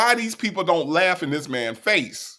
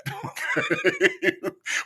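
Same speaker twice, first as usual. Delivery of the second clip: very high squeal of laughter. Real, not acted. Cracks up mid remark.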